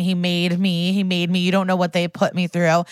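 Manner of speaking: usual speaking voice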